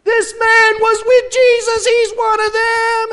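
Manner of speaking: very high, loud shout; accusing shout. Retold or imitated. Servant crying out to the crowd, shouting. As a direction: point him out to the crowd. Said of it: "accusers shouting".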